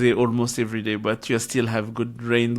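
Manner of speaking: normal talking voice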